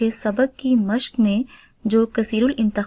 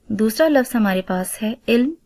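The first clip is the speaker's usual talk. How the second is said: ordinary talk, nothing marked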